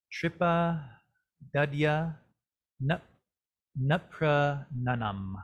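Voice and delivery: "Tripa", "Dadya", "Nuprananam" each said alone with pauses, "Nuprananam" slow; word by word. Shown as a demonstration. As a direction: spell out "Tripa" "Dadya" "Nuprananam" slowly, one at a time. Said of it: "our pronunciation for this".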